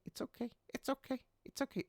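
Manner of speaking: very high, soft parrot squeak; cockatiel voice. Retold or imitated. Cockatiel soothing its crying owner, high squeaky bird voice. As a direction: chirp it like a pet bird copying you. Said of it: "and started saying".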